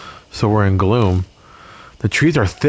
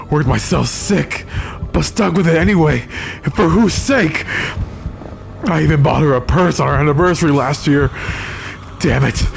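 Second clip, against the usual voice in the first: bitter rant; 5 semitones higher than usual. Acted. Despairing man rants.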